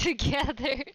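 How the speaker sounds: normal talking voice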